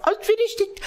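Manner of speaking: high, small-voiced "I finished it"; meek little kid. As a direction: shrink into a timid child's high voice. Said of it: "I didn't say that, but, like".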